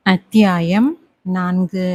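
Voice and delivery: slow, weighty chapter heading; chapter title announcement. A narrator's reading. Narrator opens chapter four with a slow announcement.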